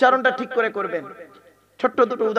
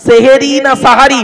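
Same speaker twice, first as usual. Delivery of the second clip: loud challenge; 2 semitones higher, 13 dB louder than usual. Acted. Preacher quizzes the crowd.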